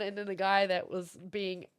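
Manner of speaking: ordinary talk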